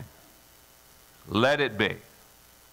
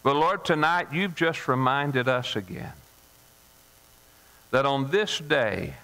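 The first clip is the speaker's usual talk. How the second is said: closing prayer; 2 semitones lower than usual. Acted. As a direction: speak gently to the Lord.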